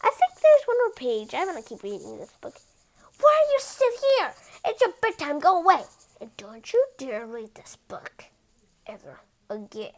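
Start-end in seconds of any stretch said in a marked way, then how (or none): 3.2-5.8 high, loud shooing; scolding. Acted. A character scolding the reader to go to bed, high and loud. 6.2-9.9 hushed threat; whispered warning. Acted. A character warning the reader off the book in a whisper.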